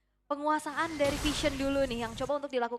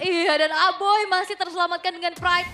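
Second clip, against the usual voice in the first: loud, high excited call; 5 semitones higher, 8 dB louder than usual. Real, not acted. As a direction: lift it with excitement. Caster reacts to a save.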